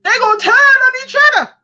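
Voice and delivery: very high, loud, fast American accent; excited American accent. Real, not acted. Reader predicting a party falling out, excited American accent. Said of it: "all kind of accents coming out".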